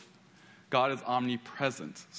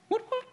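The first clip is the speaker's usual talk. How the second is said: high siren chirp imitated; mock police siren woop woop; police siren whoop. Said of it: "you know that sound".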